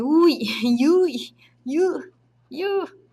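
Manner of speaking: playful squeak as a falling chair. Pretend. Teacher voicing a toppling chair, playful squeak. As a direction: squeak "ui, ui, yu, yu" like a chair tipping over. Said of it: "Ele fala assim".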